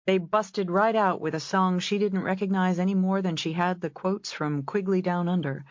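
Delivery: ordinary delivery, nothing marked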